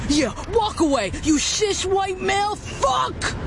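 rising to a furious high shout; heated family shouting. Acted. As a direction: scream after him as he leaves. Shouting at man walking away.

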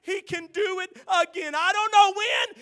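high, loud, choked voice breaking into tears; crying while preaching. Real, not acted. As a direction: cry out the promise through tears. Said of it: "preacher breaks into tears".

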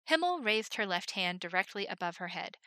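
usual speaking voice

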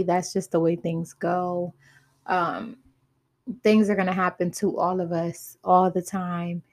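usual speaking voice

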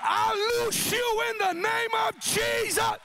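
high, loud shouted declaration; preaching at full force. Real, not acted. Preacher shouting deliverance over the congregation, full-force preaching. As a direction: cry out the deliverance over the crowd.

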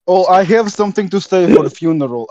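usual speaking voice

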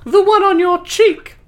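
put-on high Southern Belle accent, laughing; giggly Southern Belle voice. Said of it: "You wanted the Southern Belle voices".

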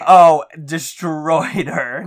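slow, stretched "Oh, destroyed her"; drawn-out emphasis. Real, not acted. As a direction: drag out "destroyed". Emphatic reply about rival.

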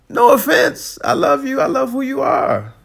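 loud. Real, not acted. Emphatic reassurance to listeners.

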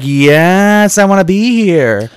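mock delight. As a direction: act blissfully thrilled. Imagined delighted flower-field reaction.

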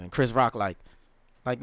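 ordinary delivery

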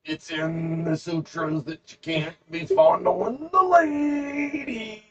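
very high, slow mock wail; drawn-out, hammed-up whiny rule-keeper; Dave. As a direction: scold in a silly high impression. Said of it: "Dave would be like".

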